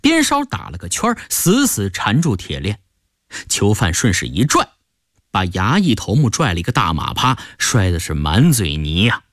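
usual speaking voice

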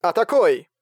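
короткий приказ повыше; отдай срочный приказ, подняв голос; резкая команда. A narrator's reading. (приказ к атаке)

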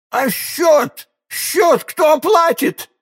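very high-pitched, drawn-out outcry; wailing yell. A narrator's reading. Innkeeper shouting after departing guest about the bill, wailing. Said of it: "завопил хозяин".

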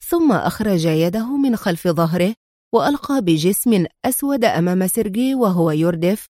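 ordinary delivery, nothing marked

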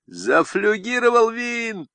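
a high, slow, straining call; straining shout. A narrator's reading. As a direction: yell till the throat hurts. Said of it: "надсаживаясь, кричал Мещук".

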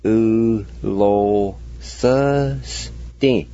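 U lo sơ sti, each syllable drawn out very slowly and evenly. Shown as a demonstration. Teacher sounding out the Cherokee word for learners, very slowly. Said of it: "And together".

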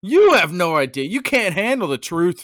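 loud, high, dramatic courtroom shout; movie-quote shout. Acted. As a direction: bellow it like a film climax.

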